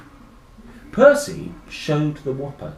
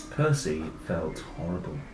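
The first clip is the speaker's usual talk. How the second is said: ordinary delivery, nothing marked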